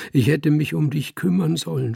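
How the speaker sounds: höher, leicht weinerlich gesprochen; schluchzend. Acted. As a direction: ein angedeutetes Schluchzen in der Stimme. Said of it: "schluchzte er". (gespieltes Schluchzen des betrunkenen Fremden)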